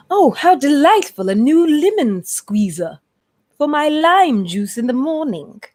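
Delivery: high, drawn-out delighted coo; delighted shopper. Retold or imitated. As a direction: act out a dainty, delighted gush. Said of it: "I be like".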